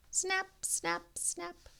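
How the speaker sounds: high, light snap snap snap; crisp snapping sounds. Acted. Pruning in the great hall, high crisp snipping sounds. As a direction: make the clippers sing.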